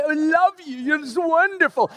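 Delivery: high, put-on syrupy sweetness; mock adoring kids. Kids arriving with gifts, mock gushing adoration. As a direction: gush like an impossibly perfect child.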